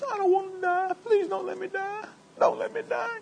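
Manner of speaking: a high, soft, pleading whine; imitating a weeping king begging God not to let him die; mock sobbing Hezekiah. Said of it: "And he starts crying".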